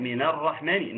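usual speaking voice

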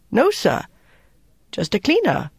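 put-on high, anxious young cook; worried young cook. A narrator's reading. Cook telling the officer it was a cleaner, worried. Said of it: "A worried-looking young cook said".

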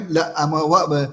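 normal talking voice

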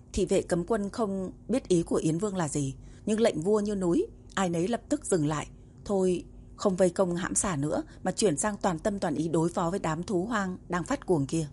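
ordinary delivery, nothing marked

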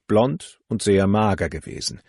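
plain reading voice

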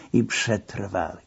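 ordinary delivery, nothing marked

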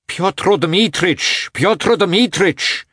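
high, slow pleading call through a door; high drawn-out calls of entreaty; entreating call. A narrator's reading. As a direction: beg him to come out, drawing the name out. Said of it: "he called out in a tone of entreaty".

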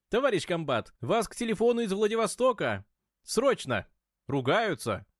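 high, loud urgent report; urgent shouting. Acted. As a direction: announce it with alarm. Officer reports an urgent call.

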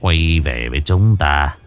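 put-on low, slow, sly old abbot voice; sinister old abbot. A narrator's reading. Demon abbot dismissing the fleeing girl, low sinister voice. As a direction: purr it with a creepy smile. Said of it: "nụ cười dị hợm".

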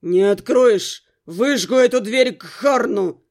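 slow, low, heavy male threat; menacing, deliberate warning; threatening ultimatum. A narrator's reading. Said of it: "меня припечатали ультиматумом".

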